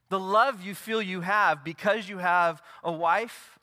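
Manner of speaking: high, loud, ringing pulpit delivery; preaching at full force. Acted. Pastor to congregation, preaching at full force. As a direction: proclaim every promise to the back row.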